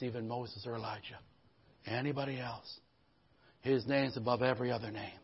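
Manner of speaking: low, quiet, intimate restatement; soft preaching. Acted. Pastor reflecting quietly to the congregation, soft and low. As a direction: say it again gently, almost to yourself.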